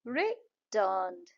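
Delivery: रिटर्न्ड slow, in two parts, रि, टर्न्ड; syllable by syllable. Shown as a demonstration. Teacher repeats two claps in रिटर्न्ड, syllable by syllable. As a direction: hold apart the two beats of रिटर्न्ड. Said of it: "रिटर्न में दो है".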